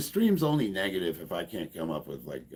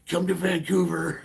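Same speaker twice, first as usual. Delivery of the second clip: higher, slow, laughing mock threat; mock angry hater; 8 semitones higher, 4 dB louder than usual. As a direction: ham up an unhinged rival's threat. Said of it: "he's like".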